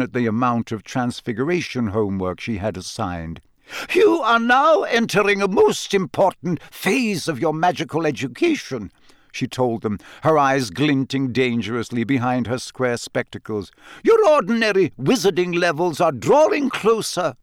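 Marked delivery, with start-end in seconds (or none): 3.9-8.9 much higher, crisp stern teacher; stern McGonagall. A narrator's reading. 14.0-17.4 much higher, crisp stern teacher; stern McGonagall. A narrator's reading. McGonagall continues.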